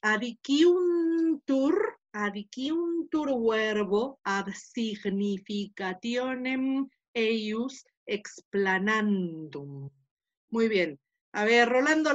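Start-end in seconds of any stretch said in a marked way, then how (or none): 0.0-9.9 syllable by syllable, adiciuntur drawn out; slow Latin reading. Shown as a demonstration. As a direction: read the Latin slowly and carefully. Teacher reads Latin slowly aloud. (lectura lenta del latín)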